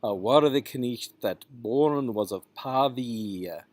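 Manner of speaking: "Pavia" ending in a separate ee-uh; "knecht" with sounded k, "Pavia" as pah-vee-uh; two-syllable ending. Shown as a demonstration. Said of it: "is a two-syllable".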